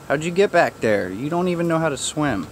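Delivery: ordinary talk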